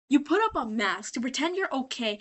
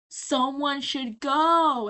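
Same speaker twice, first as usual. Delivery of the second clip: very drawn-out, meaningful words; imitating a heavy-handed nudge; pointed hint; 2 semitones higher, much slower than usual. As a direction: stretch it out as an obvious hint. Acts Baggy hinting at Husk.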